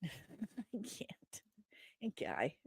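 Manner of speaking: barely voiced whisper; whispered aside. Real, not acted. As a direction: drop to a whisper, as if giving up. Overcome while reading the screen.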